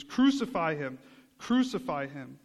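mock mob yell, punched at the start; half-shouted imitation of a demanding crowd; retold screaming. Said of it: "They are screaming at Pilate".

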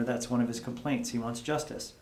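usual speaking voice

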